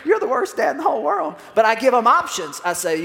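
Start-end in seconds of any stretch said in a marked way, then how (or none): 0.0-1.3 high-pitched, louder child's wail, imitated; mock protesting daughter. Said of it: "She's like".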